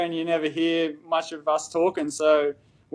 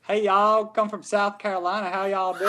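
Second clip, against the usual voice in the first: Southern US accent; 4 semitones higher, 3 dB quieter than usual. Shown as a demonstration. Australian guest trying an American accent on request, Southern twang. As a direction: try a friendly South Carolina accent. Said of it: "hanging around the Southern, so I'll try that".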